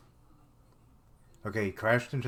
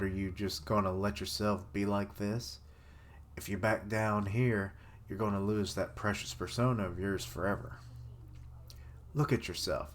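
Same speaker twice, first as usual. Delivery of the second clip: ordinary delivery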